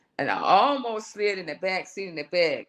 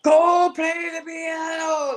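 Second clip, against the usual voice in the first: slow, high, loud held command; the voice insisting for the last time; commanding inner voice; 11 semitones higher, 5 dB louder, much slower than usual. Retold or imitated. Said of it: "here goes from again".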